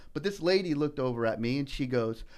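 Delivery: normal talking voice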